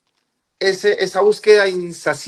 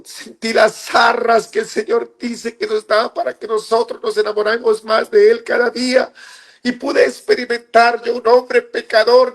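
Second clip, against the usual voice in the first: raised, earnest testimony to the congregation; fervent preaching; 5 semitones higher than usual. Acted. A believer exhorts his brothers not to lose heart, fervent preaching. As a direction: preach it out with conviction.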